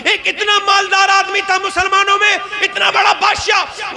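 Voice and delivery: fiery sermon delivery; preaching at full force. Acted. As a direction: thunder it out to the crowd. Preacher builds to a shout.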